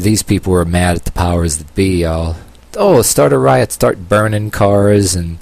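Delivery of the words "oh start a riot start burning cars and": sarcastic. Retold or imitated. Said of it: "Yeah, I'm being sarcastic here".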